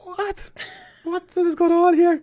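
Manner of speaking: very high-pitched, squealed bewilderment; baffled disbelief. Retold or imitated. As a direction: act out hammed-up incredulity, nearly laughing. Acting out his own bafflement.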